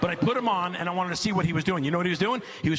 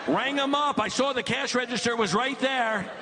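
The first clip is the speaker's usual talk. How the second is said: much higher, worked-up disbelief; exasperated rant; 9 semitones higher than usual. Real, not acted. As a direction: rant about the lazy game like it's a crime. Dad's mounting exasperation telling the story.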